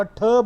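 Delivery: "ठ" as t with a puff of air; aspirated t. Shown as a demonstration. Teacher showing the t of teach, "ठ" aspirated. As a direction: release "ठ" with a burst of breath. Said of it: "यहाँ पे ये aspirated है".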